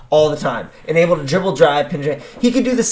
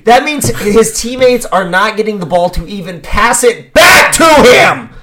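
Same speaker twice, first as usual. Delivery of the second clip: exasperated shout; 5 semitones higher, 5 dB louder than usual. Real, not acted. Co-host rants about teammates never getting the ball, exasperated shout. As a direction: build to a loud, high shout. Worked-up rant about team basketball.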